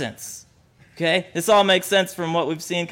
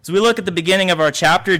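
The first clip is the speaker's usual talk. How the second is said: ordinary talk, nothing marked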